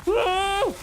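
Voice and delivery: high yelp of surprise; shrill startled cry; startled whoa. Real, not acted. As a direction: jump at the sudden fright. Sudden scare in game.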